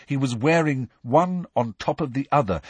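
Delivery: ordinary delivery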